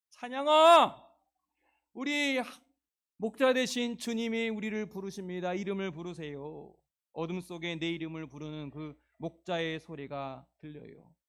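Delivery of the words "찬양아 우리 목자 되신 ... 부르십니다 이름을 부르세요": high loud call, then easing down. Acted. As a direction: shout the name across the hills. Shepherd calls out loudly.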